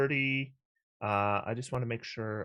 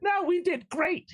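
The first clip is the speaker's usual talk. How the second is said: high squeaky rabbit voice; 4 dB louder than usual. Acted. Rabbit character reassures party.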